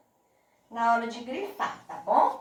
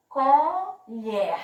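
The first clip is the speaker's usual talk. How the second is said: "Colher" split into syllables, LHER forceful; stressed final syllable; 4 semitones higher, 4 dB louder than usual. Shown as a demonstration. Teacher repeating for pupils, syllable-by-syllable "Colher" with strong LHER. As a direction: raise the pitch and separate "Colher", stressing LHER. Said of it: "falei assim com mais força".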